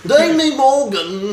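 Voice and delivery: mock British accent.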